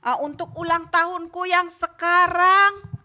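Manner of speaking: put-on child praying slow and loud for all to hear; loud prayer. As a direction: drag out the prayer so grandma hears. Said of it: "berdoanya kenceng-kenceng".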